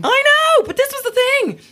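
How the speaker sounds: very high, loud squeal of objection; squealed, emphatic comeback; excited protest. Real, not acted. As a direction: burst in high-pitched to defend yourself. Defending herself in lively debate.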